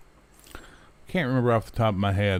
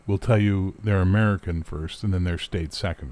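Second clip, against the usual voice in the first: ordinary talk, nothing marked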